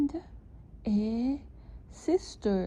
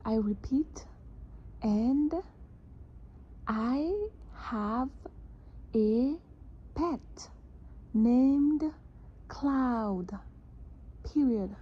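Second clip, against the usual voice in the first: one word at a time, clearly; dictating; 5 semitones higher, much slower than usual. Shown as a demonstration. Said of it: "This dictation".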